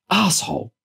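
high, exasperated gasp of an insult; indignant burst, pitched sharply up; gasped in anger. A narrator's reading. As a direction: lose your temper on one word. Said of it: "Chloe gasped, losing her temper".

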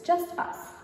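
usual speaking voice

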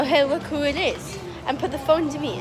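normal talking voice

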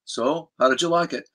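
low, soft rock star voice. Retold or imitated. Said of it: "He goes".

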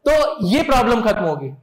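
ordinary talk, nothing marked

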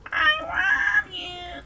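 very high, slow squeaky "I love you"; high cat voice. Shown as a demonstration. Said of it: "Come on".

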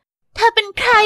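a hint of a wary young princess; Rapunzel. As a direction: ask the stranger who she is. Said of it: "เจอกับราพันเซล".

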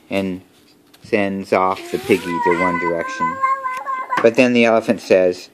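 ordinary delivery

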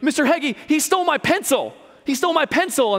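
high, loud tattling student; student whining to the teacher about a pencil, high and loud; whiny kid. Retold or imitated. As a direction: whine like a kid telling on a classmate. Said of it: "They're like".

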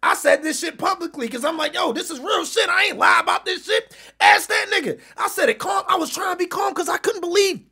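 high, urgent vouching; worked-up, high-pitched protest; heated insistence. Real, not acted. Insisting his story is true.

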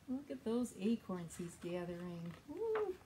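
storytime read-aloud; reading to children. Acted. Librarian reads a story to young children. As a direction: read the picture book to little ones.

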